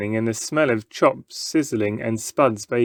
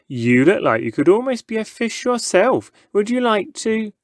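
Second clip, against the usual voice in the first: higher, playful showman voice; teasing showman; 9 semitones higher than usual. A narrator's reading. Showman teasing young Stan, playful and higher. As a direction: tempt the boy playfully.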